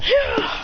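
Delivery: high-pitched attack cry; acted strike shout; battle yell. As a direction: yell high as you lunge. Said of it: "he goes".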